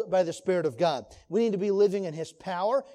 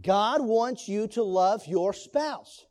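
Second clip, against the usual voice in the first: ordinary talk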